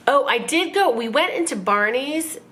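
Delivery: ordinary talk, nothing marked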